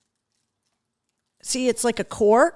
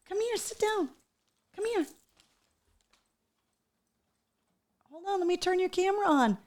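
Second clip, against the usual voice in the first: higher, softer voice to coax the dog over; 9 semitones higher, 8 dB quieter, faster than usual. Real, not acted. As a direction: gently call the dog to sit. Coaxing the dog softly.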